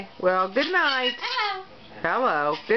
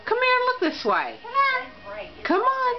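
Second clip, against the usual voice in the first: high, sweet calls to the cat; high sing-song come on; high pet-coaxing voice; 11 semitones higher than usual. Real, not acted. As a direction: coax the cat to look this way.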